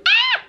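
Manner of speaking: sudden high yelp of alarm; short real cry of surprise; startled shriek. Real, not acted. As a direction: shriek as something falls. Drops the perfume bottle.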